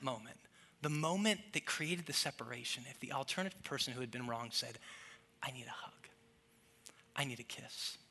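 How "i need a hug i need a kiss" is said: near-whispered, vulnerable request; very soft plea with a long pause; hushed plea. Retold or imitated. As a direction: barely voice it, low and tender. Wronged spouse asks for closeness.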